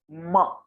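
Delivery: म with closed lips, through the nose; nasal letter demo. Shown as a demonstration. Teacher showing students lip nasal म. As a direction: press the lips for म, hum it. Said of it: "ओष्ठ और नासिका के प्रयोग से होगा".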